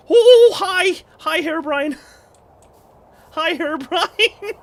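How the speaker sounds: high, loud squealing greeting; giddy high-pitched hi; excited hello. Real, not acted. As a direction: shriek a delighted hello at the mob. Greets the spooky mob.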